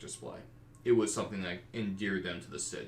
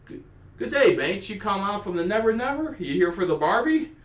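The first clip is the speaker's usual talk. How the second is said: loud, high Outback Australian accent, hammed up; a jolly Aussie dwarf greeting travellers, broad Australian accent; 12 semitones higher, 10 dB louder than usual. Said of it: "phrases of Outback Aussie Australian English".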